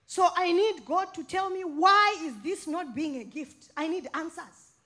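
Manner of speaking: loud pleading with God; loud, impassioned preaching; fervent cry. Acted. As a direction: build to a crying-out climax. Preaching climax, crying out.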